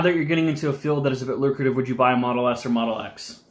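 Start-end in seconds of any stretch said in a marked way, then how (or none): none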